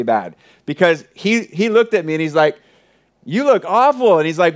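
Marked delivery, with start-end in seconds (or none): 3.2-4.2 higher-pitched mock reaction to someone's look; imitating a friend teasing, pitched well up; joking friend. Friend's joking remark acted out.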